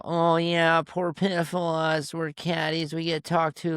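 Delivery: high, whiny mock pity for caddies; mock self-pity. Caddy mocking caddies who complain, in a high sarcastic whine. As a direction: play the poor victim sarcastically. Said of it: "you know".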